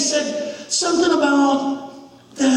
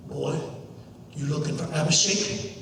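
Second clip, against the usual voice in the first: low, soft grave father; much lower, subdued grown man's voice; friend's dad; 12 semitones lower, 8 dB quieter than usual. Acted. Friend's father at the door to a boy, low and grave. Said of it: "he looks down to me, and he says".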